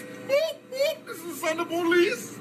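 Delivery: high whooping siren call; mock siren. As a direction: play a police siren. Mocking police siren again.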